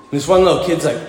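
ordinary delivery

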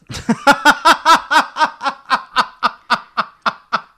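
long, loud peal of laughter; real high-pitched belly laugh; big laugh. Real, not acted. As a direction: crack up helplessly, slowly running down. Cracking up at the shoe verdict.

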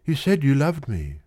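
put-on anxious girl confiding; troubled girl. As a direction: voice the worried shop girl. Girl pleads with boy.

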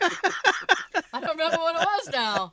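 loud, slow, through laughter; laughing. Real, not acted. Speaker laughing at own forgotten recommendation, loud laughing delivery. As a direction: laugh your way through admitting it.

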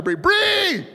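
high, loud call to someone hard of hearing. Retold or imitated. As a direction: call out sharply so Bree hears. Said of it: "I'm really talking louder".

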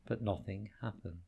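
plain reading voice

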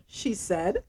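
ordinary talk, nothing marked

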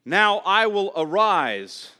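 slow, loud, majestic declaration; voice of the Lord. Acted. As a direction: proclaim it like God rising. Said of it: "says the Lord".

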